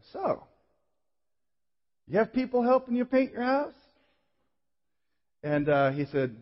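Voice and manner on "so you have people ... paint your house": high-pitched, feigned casual inquiry; falsely casual question. Retold or imitated. Speaker recalls asking his gym acquaintance the wrong question, high and falsely casual. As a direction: ask it high, as if just wondering.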